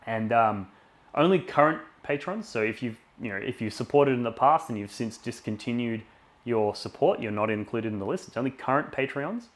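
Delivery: loud, pumped-up stream announcer; hyped giveaway announcement. Acted. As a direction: sell the prize draw with energy. Louder run as he announces the patron draw.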